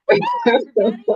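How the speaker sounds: loud, high celebratory whoop; gleeful whoop. Retold or imitated. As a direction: whoop it out as if flying downhill. Acting out gleeful skiing celebration.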